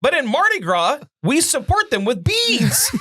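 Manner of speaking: high-pitched, worked-up rant; ranting high at normal volume; excited comic rant. Real, not acted. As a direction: pitch soars in comic indignation. Hammed-up rant about Mardi Gras.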